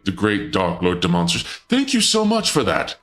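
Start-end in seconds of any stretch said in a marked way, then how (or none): 1.4-3.0 high, gushing thank-you; delighted high-pitched thanks; excited thanks. Real, not acted. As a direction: squeal out gratitude to the donors. Thanking donors on stream.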